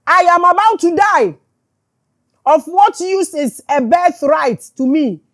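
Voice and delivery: loud, high plea of a dying man; desperate Esau. Acted. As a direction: cry it out as if starving to death. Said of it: "Esau said".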